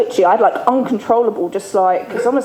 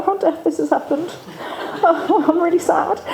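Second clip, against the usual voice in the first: higher, laughing mock-sad plea; mock whiny confiding; 9 semitones higher, 3 dB quieter than usual. Telling the alpaca Daffodil her troubles, mock sad and higher. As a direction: pour out troubles to a pet, higher and half-laughing.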